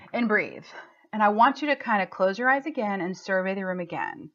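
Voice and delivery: usual speaking voice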